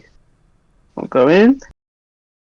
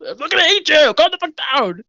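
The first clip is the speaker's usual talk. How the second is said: irritated guard; 10 semitones higher, faster than usual. Acted. Elijah answering.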